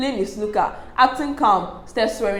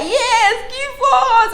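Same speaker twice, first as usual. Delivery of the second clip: excited cheer; 4 dB louder, much slower than usual. Retold or imitated. Viewers cheering the fight on.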